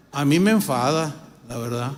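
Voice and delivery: ordinary delivery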